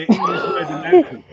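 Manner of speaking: high, loud and fast, laughing through it; mock indignation, cracking up; laughing mock outrage. Real, not acted. Teased about pronunciation, he laughs back incredulously. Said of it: "say it in an accent".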